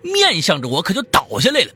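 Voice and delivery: loud, high, urgent storytelling; excited high-pitched storyteller at full force; frantic climax narration. A narrator's reading. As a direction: belt out the sudden disaster.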